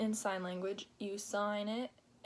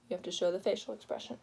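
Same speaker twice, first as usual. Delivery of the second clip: ordinary delivery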